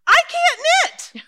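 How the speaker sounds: high, loud and slow wail of dismay; exasperated cry. Acted. Old lady wailing to her friend that she can't knit, high and loud. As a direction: throw up your hands and cry it out. Exasperated outburst about knitting.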